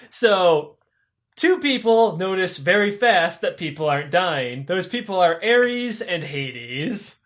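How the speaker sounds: normal talking voice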